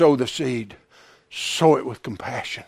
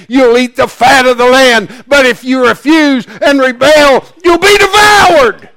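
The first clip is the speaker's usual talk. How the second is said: full-force scripture declamation; 8 semitones higher, 12 dB louder than usual. Real, not acted. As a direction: build from firm recitation to a high shout.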